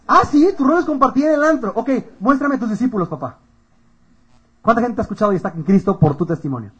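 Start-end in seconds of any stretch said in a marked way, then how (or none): 0.1-3.4 high, loud mock belief; sarcastic challenge. Responde con sarcasmo a la excusa. (reto sarcástico en voz alta)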